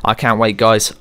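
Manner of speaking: usual speaking voice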